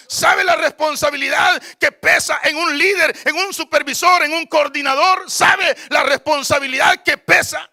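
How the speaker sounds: loud, driving sermon with piled-up phrases; forceful preaching. Real, not acted. Preacher challenging deacons and leaders who quit, forceful preaching. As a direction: hammer each item at the congregation.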